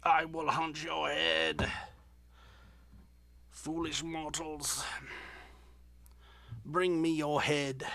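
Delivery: slow menacing villain voice; dark lord. Pretend. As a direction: speak slowly as a sinister dark lord. Toy figure voiced as villain.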